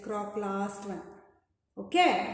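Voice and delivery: ordinary delivery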